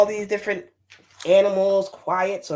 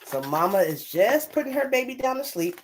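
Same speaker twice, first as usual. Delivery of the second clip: ordinary delivery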